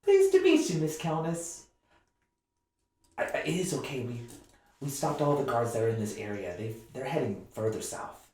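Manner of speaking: put-on high, bright girlish voice; young half-orc girl. Dorothea coaxing the hiding player out, high girlish voice. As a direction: coax them out like an eager kid.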